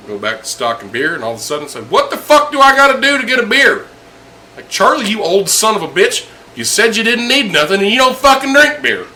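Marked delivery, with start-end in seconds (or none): 1.9-3.9 high, loud gruff demand; mock yell. Grumpy old customer yells from below the bar. 4.7-9.1 high, loud and fed up; exasperated yell. Retold or imitated. Bartender yells back at grumpy Charlie.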